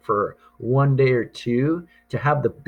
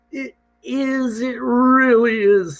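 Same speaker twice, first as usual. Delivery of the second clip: put-on much higher, a child's breathless delight; excited little girl; 14 semitones higher, 4 dB louder than usual. A narrator's reading. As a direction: squeal it like a thrilled little girl. Christina admires the box.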